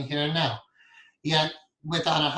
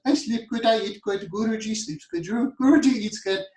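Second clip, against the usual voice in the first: high, loud imitation of an Indian guru's accent; playful Indian accent, pitched up and bright with fun; 7 semitones higher, 4 dB louder than usual. Guru boasting to students, in a playful Indian accent. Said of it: "fun Indian accent".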